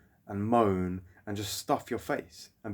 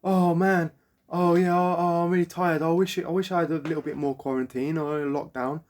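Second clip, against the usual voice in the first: whiny mockery; 4 semitones higher, 4 dB louder than usual. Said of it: "you'll be like".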